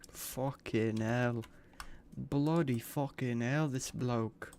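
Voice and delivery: hammed-up British swearing, slowed; jokey British accent cursing at the wrong NPC; mock British accent. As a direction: play a cartoon British bloke, drawn out. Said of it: "[name]'s British now".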